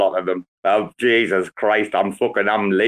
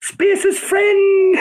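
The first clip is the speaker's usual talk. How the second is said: very high squealed catchphrase; Inbetweeners impression; 4 dB louder than usual. Teasing a pal with a comedy catchphrase, squealed mocking impression. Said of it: "Have you seen The Inbetweeners, no?".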